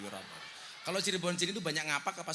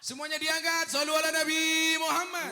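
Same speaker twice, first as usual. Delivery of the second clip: calling out; 10 semitones higher, 6 dB louder than usual. Real, not acted. Urging crowd to raise phones.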